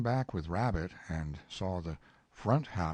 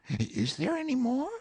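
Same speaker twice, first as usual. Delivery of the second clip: high, hopeful bear voice, slightly louder; quick eager Pooh; 5 dB louder than usual. Acted. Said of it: "asked Pooh quickly".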